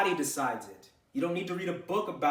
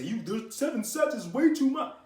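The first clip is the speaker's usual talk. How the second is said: higher, put-on alarmist voice; mock scaremonger; 7 semitones higher, 4 dB louder than usual. Coach mocks whoever scared the trainee off seven sets, mock scaremonger. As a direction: mimic someone warning with exaggerated alarm. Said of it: "did they scare you and say".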